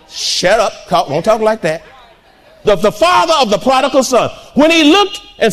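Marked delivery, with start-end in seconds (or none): none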